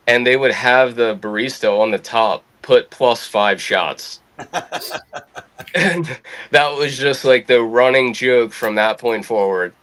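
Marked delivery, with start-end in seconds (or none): 4.4-5.7 quiet chuckle at own story; soft laugh. Real, not acted. Storyteller recalling the running espresso prank, chuckling softly. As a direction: let out a soft run of laughter.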